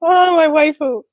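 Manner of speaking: gushing fan squeal; excited anime fan. Real, not acted. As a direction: squeal over your favourite character. Fan gushing over favourite character.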